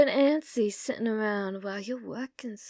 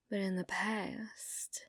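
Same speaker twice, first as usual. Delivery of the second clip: whisper; 2 semitones lower, 11 dB quieter, slower than usual. Acted. Whispered confession to bartender.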